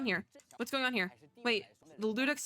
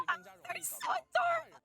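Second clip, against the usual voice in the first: gushing squeal. Retold or imitated. Streamer imitating herself gushing over Melusine, very high. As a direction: coo like a fan melting. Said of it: "I'm just like".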